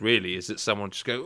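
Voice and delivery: normal talking voice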